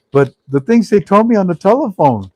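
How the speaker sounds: high, loud, incredulous retelling; imitating cracked-up caller. Caller telling host about the bank's call, imitated as cracking up. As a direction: act out an amazed man on the phone. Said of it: "The guy's cracking up".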